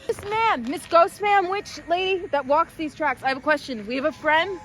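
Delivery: calling out to a spirit. Real, not acted. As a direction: project it, addressing an unseen ghost. Calling out to a ghost.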